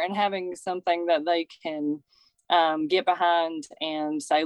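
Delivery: usual speaking voice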